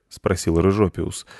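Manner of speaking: ordinary delivery, nothing marked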